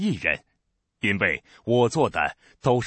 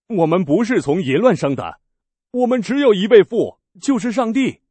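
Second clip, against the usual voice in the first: climbing much higher and louder to a shrill protest; indignant outcry; 10 semitones higher, 8 dB louder than usual. Acted. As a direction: hurl the denial back at him. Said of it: "他们说".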